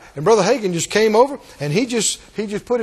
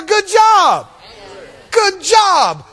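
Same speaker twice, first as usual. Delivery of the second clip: joyful praise; 9 semitones higher, 5 dB louder, much slower than usual. Acted. Jesus praising, excited.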